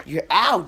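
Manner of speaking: sudden loud high ow; real yelp as the dog catches his face; yelp of pain. Real, not acted.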